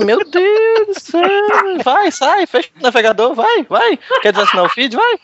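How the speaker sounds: mock exasperated.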